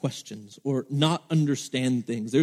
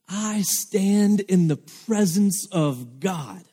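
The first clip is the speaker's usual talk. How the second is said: slow, higher, solemn angel's decree; stern angel; 4 semitones higher, much slower than usual. Acted. Angel rebuking the doubting priest, slow and solemn. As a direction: proclaim it slowly as an offended angel. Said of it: "the angel was angry".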